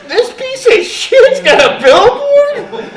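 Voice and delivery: high, loud, slow, laughing outrage; laughing disbelief. Retold or imitated.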